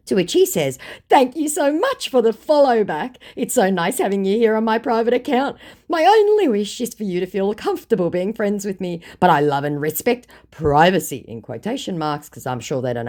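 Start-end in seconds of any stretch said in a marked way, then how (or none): 1.1-11.3 scammer voice. Retold or imitated. Voicing scammer's messages.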